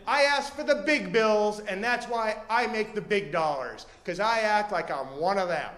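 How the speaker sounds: hustler's proud boast. Retold or imitated. Panhandler bragging to the bus driver, loud proud boast. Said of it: "and he said".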